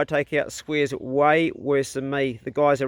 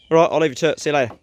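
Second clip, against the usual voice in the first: ordinary talk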